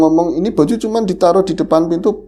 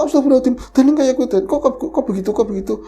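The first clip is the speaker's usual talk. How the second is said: higher pitched, startled exclamation; a mother recoiling in horror; shocked mother; 8 semitones higher, much faster than usual. Retold or imitated. (ibu kaget melihat jenazah)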